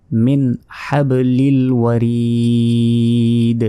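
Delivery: slow level recitation, ওয়ারিদ with ri held very long; long stop recitation. Shown as a demonstration. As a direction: draw out the last vowel of ওয়ারিদ, close on d. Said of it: "শেষে তিন আলিফ টেনে পড়তে হবে".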